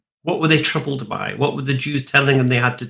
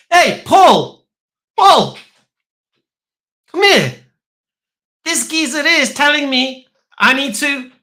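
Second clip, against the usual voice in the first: much higher, louder hammed-up yell for help; panicked call for Paul; 13 semitones higher, 6 dB louder, slower than usual. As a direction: shout across the room in mock alarm. Convert calls Paul in panic.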